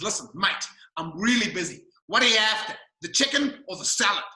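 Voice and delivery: impatient Aussie bogan waiter; rough Australian, too busy to wait; broad Australian accent. Retold or imitated. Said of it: "broad Australian accent".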